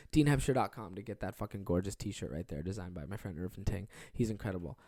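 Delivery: normal talking voice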